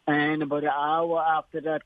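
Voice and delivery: ordinary delivery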